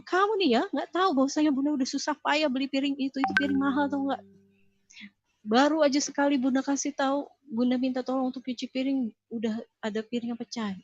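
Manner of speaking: high-pitched angry scolding mother; venting mother. Retold or imitated. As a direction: let the anger spill out at the child over the broken plate. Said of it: "orang tua yang melampiaskan emosi".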